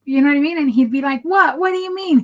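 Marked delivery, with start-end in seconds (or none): 1.2-2.2 imitating an indignant husband, pitch jumping up; getting mad. Husband caught dozing on date night, mock indignant. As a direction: act out his irritated, high defensive protest. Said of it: "He kept getting mad".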